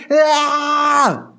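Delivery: high, sustained fake bawling; imitated tantrum cry; mock child's wail. Nine-year-old crying for hours, mock wail. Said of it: "crying for".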